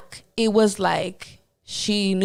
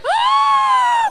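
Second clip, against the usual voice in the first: piercing high gasp, much louder; loud shocked gasp; 7 dB louder than usual. Retold or imitated. Gasping in horror at more hair on the floor.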